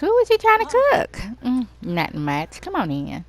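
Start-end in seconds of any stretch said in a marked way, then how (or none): 0.0-1.1 very high, loud disbelief; shocked friend. Pretend. Bree squealing at her friend about the kitchen fire, very high and loud.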